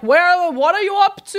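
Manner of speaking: loud, high mock-suspicious questioning; nervous mom. Mother checking on her adventurous daughter, retold as loud nervous prying. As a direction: imitate an anxious mother checking up. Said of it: "now she's more nervous".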